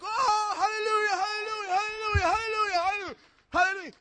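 high, loud, crying-out hallelujahs; ecstatic shouted hallelujahs. Retold or imitated. Healed man dancing and shouting for joy, high and loud.

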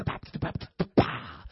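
percussive scatted syllables; mock tongues as scat; playful scat. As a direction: scat nonsense syllables, landing on the last. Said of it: "like scat".

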